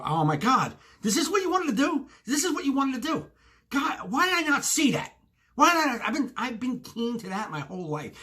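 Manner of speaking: high-pitched mock disbelief at himself; exasperated realisation. Customer realising what the cashier was after, high exasperation. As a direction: wail it high, kicking yourself for missing it.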